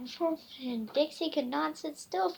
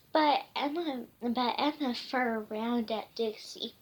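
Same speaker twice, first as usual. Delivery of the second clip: ordinary delivery, nothing marked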